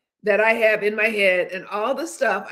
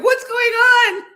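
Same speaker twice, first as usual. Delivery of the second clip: startled nerves; 7 dB louder than usual. Pretend. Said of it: "my nervous system is going".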